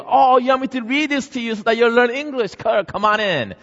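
light up and wave her in; delighted neighbor. Acted. Neighbor Missus Smith inviting Missus Kim inside, high and welcoming.

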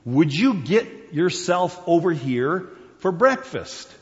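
high, slow, punched-out order; insistent parent calling to breakfast. Acted. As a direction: order the dawdling kid in, word by word. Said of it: "that's getting a little more emphatic".

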